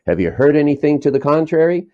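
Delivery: imitating Cheney snapping angrily at the soldier; angry retort. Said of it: "whips his head around angrily and says".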